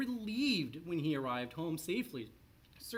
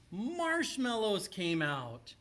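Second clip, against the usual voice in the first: slow, loud, higher reveal of the surprise; dramatic reveal; 6 semitones higher, 5 dB louder, slower than usual. A narrator's reading. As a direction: land the punchline big and slow.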